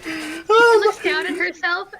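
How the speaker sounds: loud, slow, laughing narration; laughing through words. Real, not acted.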